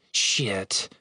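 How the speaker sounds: low, soft grumbled curse; annoyed low curse. Acted. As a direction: grumble it under your breath. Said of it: "she grumbled".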